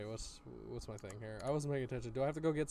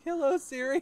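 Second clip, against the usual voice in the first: Siri wake call; 10 semitones higher, 5 dB louder than usual. Retold or imitated. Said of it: "It's like".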